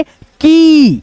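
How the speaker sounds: "की" stretched on a long ee, falling at the end; drawn-out vowel. Shown as a demonstration. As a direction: hold the ee in "की" well past normal length. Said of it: "ध्वनि को खींचेंगे". ("की" की ध्वनि खींचकर)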